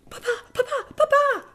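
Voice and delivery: put-on very high, loud children's shriek. Four boys shrieking to their father, very high excited cry. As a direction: squeal like four little eaglets. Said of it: "strillano i quattro ragazzi".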